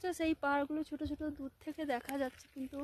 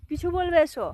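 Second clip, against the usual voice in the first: calling out; 4 semitones higher, 11 dB louder than usual. Real, not acted. Calling out to distant brother.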